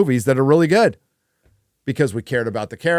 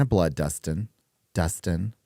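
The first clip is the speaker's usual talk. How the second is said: put-on Hollywood Reporter accent; 6 semitones lower, 5 dB quieter than usual. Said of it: "Hollywood Reporter accent".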